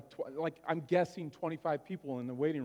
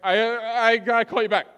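louder, rushed brush-off on the phone; hurried mom; 4 semitones higher, 10 dB louder than usual. Retold or imitated. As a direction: cut the call short in a hurry. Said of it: "she's like".

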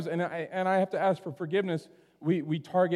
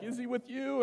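higher, softer worried question; imitated panicking mom; 6 semitones higher, 7 dB quieter than usual. Mom calling a friend about her missing son, imitated panic. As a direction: imitate an anxious mom, high and hushed. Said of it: "I heard her kind of panicking".

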